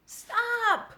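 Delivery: very high, loud scream. A narrator's reading. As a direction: cut her off with a shriek. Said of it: "screamed Wilbur".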